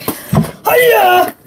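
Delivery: high "hiya!" karate yell; karate cry. Pretend. Kid showing her signature wrestling move with a karate cry. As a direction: let out a mock kung fu shout. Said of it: "but I'm like".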